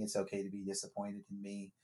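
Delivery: ordinary talk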